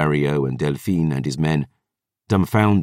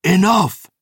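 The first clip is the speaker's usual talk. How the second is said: high, loud commanding roar; 12 semitones higher, 4 dB louder than usual. Acted. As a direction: shout to silence the room. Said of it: "The historian's roar".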